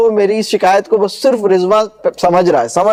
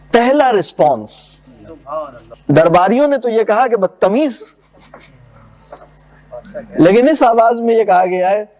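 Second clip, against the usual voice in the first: normal talking voice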